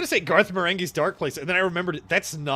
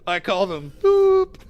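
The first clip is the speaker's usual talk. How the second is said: slow, loud, raised declaration; silly loud proclamation; 5 semitones higher, 8 dB louder, slower than usual. Acted. Quoting the cartoon, slow and loud. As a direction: announce it proudly and absurdly.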